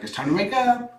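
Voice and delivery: high, rising mock cat voice; cat waking owners. Voicing the cat that wakes them, high and insistent. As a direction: chirp it upward like an eager cat. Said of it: "It's like".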